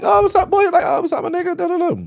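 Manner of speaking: squeaky, nervous friendly hello; high fake-casual greeting.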